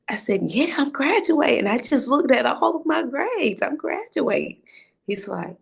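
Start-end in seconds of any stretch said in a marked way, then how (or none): 0.4-4.6 excited. Retold or imitated. Telling dad she's graduating.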